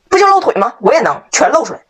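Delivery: high-pitched mock defiance, then dropping; defiant boy. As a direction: answer the taunt like a stubborn kid. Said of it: "一边脱一边说". (不服气地尖声顶嘴)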